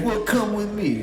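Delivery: mock officer order. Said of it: "And he's like".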